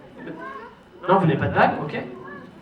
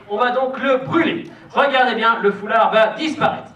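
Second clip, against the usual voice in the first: put-on kids party magician; 6 semitones higher, 5 dB louder, slower than usual. Magician announces trick to audience.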